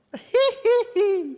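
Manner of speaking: high, loud cackle of disbelief; disbelieving laugh. Acted. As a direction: laugh at the idea as a joke. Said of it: "I began to laugh".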